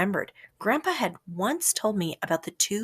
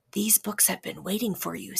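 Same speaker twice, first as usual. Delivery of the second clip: gentle lion voice; 6 dB quieter than usual. A narrator's reading. Lion Patience speaks gently.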